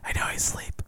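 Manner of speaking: soft whisper so the puppy sleeps. Real, not acted. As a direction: whisper so you don't wake the puppy. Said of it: "We gotta whisper".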